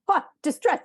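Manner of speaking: mock panic. Parent acting out her own distress, mock-panicked and loud. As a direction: play up the freak-out of a stressed mom. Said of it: "I'm like".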